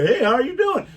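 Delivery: high, loud chummy greeting; voiced as an easygoing cop; mock friendly cop. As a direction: play a cop calling out a breezy hello. Voicing friendly cops beside speeders.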